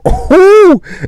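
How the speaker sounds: high-pitched wordless "whoo"; long, high exclamation; exasperated whoop. Real, not acted. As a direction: let off steam with a high hoot. Exasperated outburst midway through angry story.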